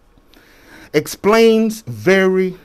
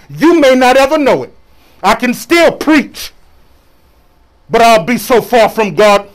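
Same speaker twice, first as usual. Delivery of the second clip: forceful, raised sermon delivery; preaching; 6 semitones higher, 7 dB louder than usual. Real, not acted. Preacher confessing he can still preach, preaching. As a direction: preach it hard to the congregation.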